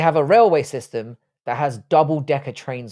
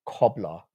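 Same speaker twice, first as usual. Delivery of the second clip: COBBLER said low, on a falling tune; savouring the word; 6 semitones lower, 3 dB quieter than usual. Shown as a demonstration. Streamer enjoying a pudding's name, COBBLER savoured low. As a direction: let COBBLER drop in pitch, relishing its sound. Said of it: "I like the way it sounds".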